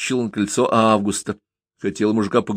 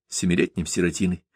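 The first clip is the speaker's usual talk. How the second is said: ordinary delivery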